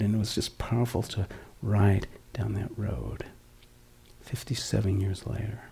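softly whispered, moved by memory; hushed whisper. Real, not acted. Recalling his childhood road, hushed and whispered. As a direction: let it fall to a whisper.

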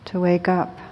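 ordinary delivery

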